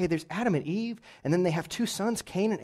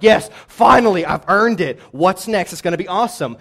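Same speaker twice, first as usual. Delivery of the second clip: loud, triumphant yes; triumphant cheer; 2 semitones higher, 10 dB louder than usual. Retold or imitated. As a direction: celebrate finally getting through. Finishing Leviticus, triumphant.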